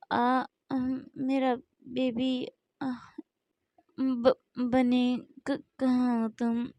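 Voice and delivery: drowsy, slow, just-woken mumble; sleepy waking voice. Acted. Jimin waking, calling for his baby, drowsy. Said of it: "Jimin की ऐसी आवाज़ सुनते हैं".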